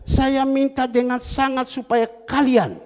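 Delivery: loud, slow, weighty preaching; preacher driving home a verse; emphatic scripture reading. Acted. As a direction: declaim the verse slowly and forcefully.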